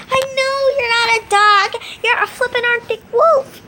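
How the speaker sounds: high, loud, snippy comeback; sassy retort. Acted. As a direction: snap back at the wolf.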